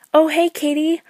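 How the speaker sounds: high, loud forced-casual greeting; fake cheery voice. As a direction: squeak it out, pretending all is fine. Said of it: "My voice was too high-pitched and fake".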